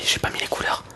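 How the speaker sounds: ordinary talk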